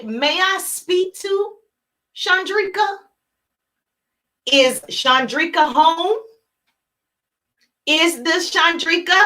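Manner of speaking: slow, hesitant asking; slow, stiff and formal mock asking; wrong way, timid. Shown as a demonstration. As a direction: drag the question out unsure. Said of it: "You're not gonna say".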